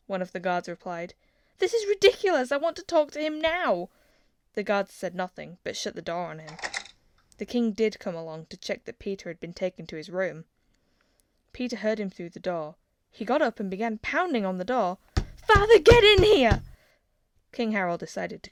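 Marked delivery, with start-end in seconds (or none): 1.6-3.9 high, loud indignant outburst. A narrator's reading. Prince protests to guards. 15.5-16.6 high, loud yell through a door; furious yell. A narrator's reading. Pounding on the door.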